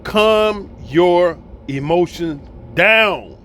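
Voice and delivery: word by word, at half speed; slow, deliberate calming. Real, not acted. Telling a quarrelling couple to stop hollering, slow and deliberate. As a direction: space out each word to settle them.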